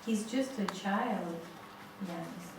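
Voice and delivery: gently calm an angry husband; wife quietly soothing her furious husband, soft and slow; calmly soothing. Retold or imitated. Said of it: "she tries to very calmly say to him".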